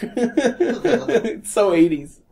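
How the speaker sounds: laughing through the words. Real, not acted. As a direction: laugh it out high. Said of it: "laughing through words".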